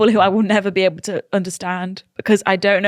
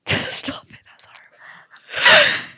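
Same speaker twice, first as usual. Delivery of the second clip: disgusted whisper; much slower than usual. Real, not acted. Disgusted at bogey wall.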